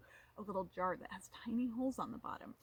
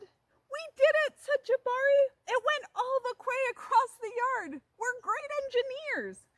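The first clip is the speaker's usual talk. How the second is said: high, loud triumphant shout; 11 dB louder than usual. Acted. Jabari celebrating with dad and sister, high loud joy. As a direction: celebrate like you just won.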